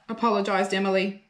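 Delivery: ordinary delivery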